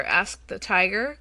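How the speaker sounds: ordinary delivery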